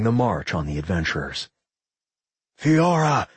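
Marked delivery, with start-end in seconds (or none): 2.6-3.3 higher-pitched call for help; crying out. A narrator's reading. Gran calling Fiora amid the horde, crying out. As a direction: call a comrade's name urgently. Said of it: "Gran cried out".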